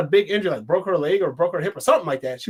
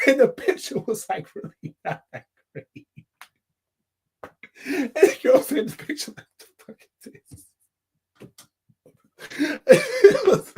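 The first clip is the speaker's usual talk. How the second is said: breathless laughing, words falling apart; real laughing fit mid-story; helpless laughter; 8 semitones higher than usual. Real, not acted. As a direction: try and fail to finish through laughter. Cracking up over the bad picture.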